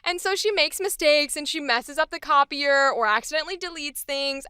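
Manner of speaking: ordinary talk, nothing marked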